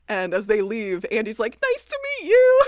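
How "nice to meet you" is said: much higher and louder, sing-song; high fluttery greeting; imitating Andy. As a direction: chirp it like a starry-eyed assistant.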